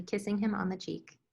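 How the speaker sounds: ordinary delivery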